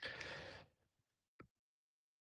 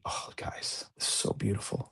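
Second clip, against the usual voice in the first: awed hush; 3 semitones higher, 8 dB quieter than usual. Real, not acted. Awed hush over the early night.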